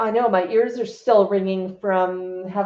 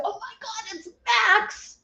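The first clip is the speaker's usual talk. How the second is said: much higher, thrilled squeal at meeting the bird; thrilled fan; 12 semitones higher, 3 dB quieter, slower than usual. Pretend. Bird fan meeting Max, squealing with delight. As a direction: gush like a fan spotting a celebrity parrot. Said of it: "and you said".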